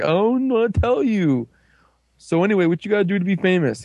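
high, hurried mock voice; evasive hobo guy. Retelling the blogger's reply to friends, evasive and high.

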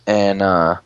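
usual speaking voice

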